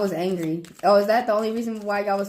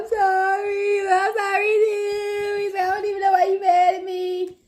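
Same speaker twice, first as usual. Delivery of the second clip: mock apology; 11 semitones higher, 3 dB louder than usual. Voicing friends making up.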